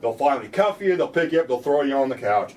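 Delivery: ordinary talk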